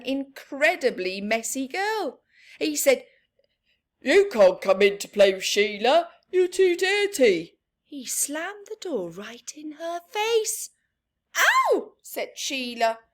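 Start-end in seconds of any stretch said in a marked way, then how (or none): 4.0-7.6 loud, fast stern rebuff; father scolding at the door. Acted. 11.4-11.9 yelp of pain. Acted. Door slammed on her.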